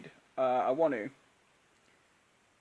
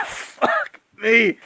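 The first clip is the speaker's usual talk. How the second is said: strained, hurting voice; 5 semitones higher, 11 dB louder than usual. Real, not acted. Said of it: "I just killed my voice".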